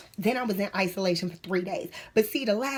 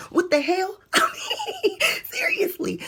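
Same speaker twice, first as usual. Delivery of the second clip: high disbelieving laugh; what the hell, laughing in disbelief; mock outrage, laughing; incredulous; 11 semitones higher than usual. Real, not acted.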